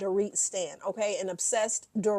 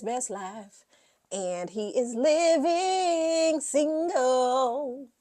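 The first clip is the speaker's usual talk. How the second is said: mocking sing-song; 6 semitones higher, 5 dB louder, much slower than usual. Real, not acted. Mocking the husband's new life.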